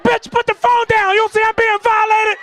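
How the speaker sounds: high, frantic indignant yell; outraged protest. Retold or imitated. Swimmer to lady filming him, outraged protest. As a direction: shout back in mock outrage.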